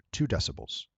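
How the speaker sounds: ordinary talk, nothing marked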